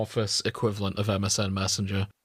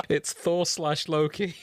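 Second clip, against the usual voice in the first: laughing it out, slower, higher; 6 semitones higher, slower than usual. Real, not acted. Tells friend the top fic is Thor slash Loki, laughing. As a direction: giggle through the reveal.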